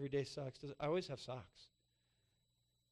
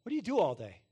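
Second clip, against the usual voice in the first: louder, higher, needling mock question; passive-aggressive; 5 semitones higher, 13 dB louder than usual. A husband needling his wife about chores, passive-aggressive. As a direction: slip the accusation in sideways, much louder. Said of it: "passively aggressively come in the back door".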